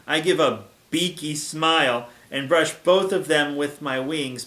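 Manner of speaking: plain reading voice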